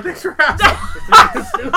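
laughing. Real, not acted. Jokes about the sweater, laughing.

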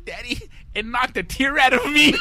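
put-on high, loud tearful kid voice; hammed-up child's sobbing protest; mock crying child. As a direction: wail it like a little boy fighting tears. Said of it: "he's trying not to cry".

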